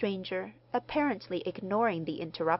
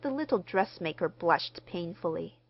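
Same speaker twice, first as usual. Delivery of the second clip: ordinary delivery, nothing marked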